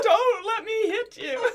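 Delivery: high, slow, laughing plea; pet-talk voice. Retold or imitated. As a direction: plead with the squirrel through laughter. Joins the squirrel voice.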